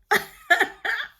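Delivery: loud, high laugh; real burst of laughter. Real, not acted. Amused at her own dating aside, loud real laugh.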